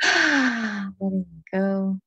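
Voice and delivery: very slow, sighed letting go. Real, not acted. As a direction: breathe the words out slowly. Sigh of release.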